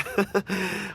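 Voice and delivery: quick soft chuckle mid-sentence; light laugh. Acted. Merchant amused at his own joke, soft chuckle.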